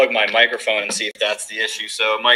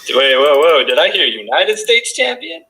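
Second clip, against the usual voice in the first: mock surprise; 5 semitones higher, 4 dB louder than usual. Mock surprise at wrong title.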